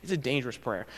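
normal talking voice

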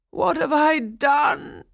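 high, slow anguished shout; anguished cry. A narrator's reading. Old man despairing as his doll runs off, high slow shout. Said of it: "cried the old man".

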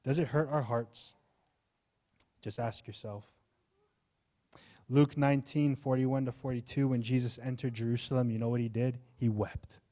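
normal talking voice

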